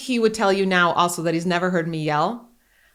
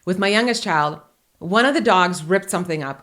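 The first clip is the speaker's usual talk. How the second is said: usual speaking voice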